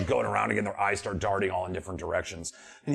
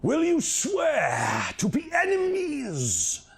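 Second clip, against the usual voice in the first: shrieking bird spirit; 9 semitones higher, much slower than usual. Acted. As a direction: shriek then hiss the question.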